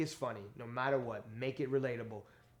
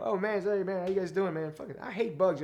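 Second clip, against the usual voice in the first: mock cheesy stand-up greeting, higher and louder; hacky comic opener; 6 semitones higher, 6 dB louder than usual. Comic mocking his own early set, hacky greeting. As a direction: ham up a lazy club comic's opening. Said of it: "hacky way you start your joke".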